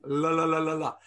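"La, la, la" sung with the tongue tapping the palate; tongue-tip la demo; la sound demo. Shown as a demonstration. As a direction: demonstrate the la on the palate. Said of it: "put your tongue up to the palate".

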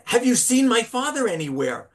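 put-on a boy anxiously asking a passerby where his dad is; agitated asking. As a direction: ask it as a worried child losing his father. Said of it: "getting more and more agitated".

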